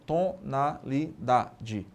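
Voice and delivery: "Tonalidade" slowly chopped into its syllables; one separate sound per syllable of "Tonalidade", pausing between. Shown as a demonstration. Teacher showing each sound is a syllable, "Tonalidade" split slowly syllable by syllable. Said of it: "Cada emissão de som é uma sílaba".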